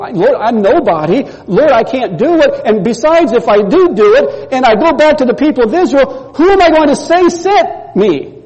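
rising high, anxious protest; pleading Moses. Retold or imitated. Moses protesting his call to God, pleading and rising.

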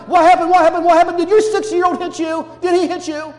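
loud, high, urgent repeated questions; frantic questioning. Acted. Grandparent to crying four-year-old in the hallway, frantic questioning. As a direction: fire questions at a crying child in alarm.

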